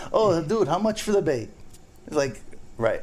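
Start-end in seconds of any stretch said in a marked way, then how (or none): 0.1-1.5 higher-pitched mimicked bait scrounger. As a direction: lightly put on an eager scrounger.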